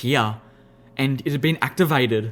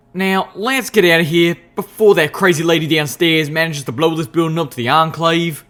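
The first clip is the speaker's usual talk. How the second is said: louder, pressing urgency; urgent; 3 semitones higher, 6 dB louder than usual. Acted. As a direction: hurry them out urgently. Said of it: "Calamity suggested urgently".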